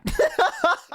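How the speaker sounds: squealing high laugh; a sudden high cackle at the joke; high burst of laughter. Real, not acted. Streamer cracking up at "where's Bad Britain", high laugh.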